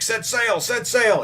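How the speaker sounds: high, loud urgent call; urgent shout. Retold or imitated. Nami running to the ship, shouting high and loud. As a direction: yell it at the crew, hurrying them.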